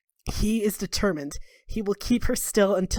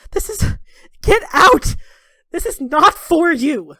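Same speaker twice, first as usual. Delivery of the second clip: high, loud, slow shout; angry shout; 8 semitones higher, 8 dB louder than usual. Acted. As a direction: order her out, heartbroken. Talgon angrily driving her out.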